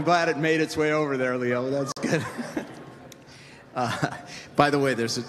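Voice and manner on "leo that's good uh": ordinary talk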